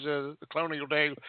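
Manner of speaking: usual speaking voice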